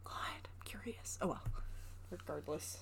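hushed whisper so the neighbor can't hear; keeping it down for the angry neighbor, whispering. Real, not acted. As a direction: breathe it, no voice. Neighbor banging to shut up.

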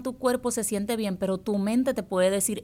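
ordinary delivery

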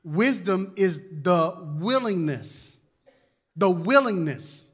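slow, weighted emphasis on "willingness"; stress on willingness. Shown as a demonstration. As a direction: land hard on "willingness", drawing the phrase out slowly. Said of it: "I wanna emphasize this word".

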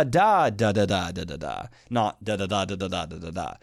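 "da" beats chanted, stressed ones hit harder; scanning syllables. Shown as a demonstration. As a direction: tap out the meter with da da da. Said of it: "There's an iamb because it's".

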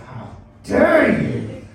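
slow, loud mock-outraged shout; indignant outburst. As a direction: bellow it, pretending to be deeply offended. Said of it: "I rose to it immediately".

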